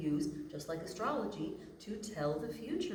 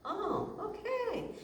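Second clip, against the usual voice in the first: dawning realization; slower than usual. Retold or imitated. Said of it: "you figure out".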